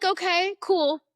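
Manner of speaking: pretending to be fine while scared; a touch of mock casual; nervous forced calm. Nervously agreeing to frisk.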